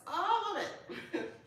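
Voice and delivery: high and loud, thrilled with joy; delighted squeal. Real, not acted. Vlogger showing cards, squealing with delight. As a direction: gush over the cards.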